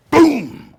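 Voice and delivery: huge, shouted booming crash; loud boom. Acted. Storyteller marking the falling body with a shouted boom. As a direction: blast the boom like a body hitting the floor.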